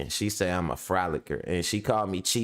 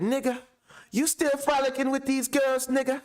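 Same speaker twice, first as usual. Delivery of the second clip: high scolding mom voice; imitating mom. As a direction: nag your son in a high mother's voice. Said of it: "She be like".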